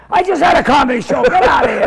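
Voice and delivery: imitating an old man's loud, quick brush-off; mock-irate dismissal; dismissive shout. Old man throws him out.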